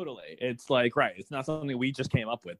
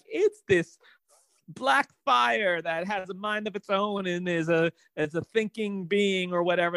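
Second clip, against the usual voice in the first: mock dramatic reveal; 6 semitones higher than usual. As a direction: overplay a silly big reveal. Mocking the show's disappointing reveal.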